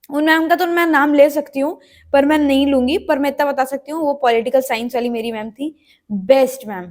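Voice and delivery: usual speaking voice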